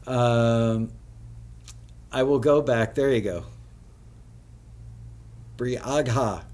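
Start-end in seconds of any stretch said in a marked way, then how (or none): 5.6-6.4 Briagha sounded out as written, bree-ag-ha; said as spelled. Shown as a demonstration. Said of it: "but it's pronounced Bria".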